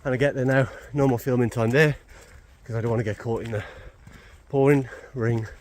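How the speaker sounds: breathless after hurrying uphill; out of breath. Real, not acted. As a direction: talk while catching your breath. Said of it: "and I'm quite out of breath".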